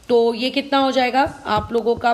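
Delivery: ordinary talk, nothing marked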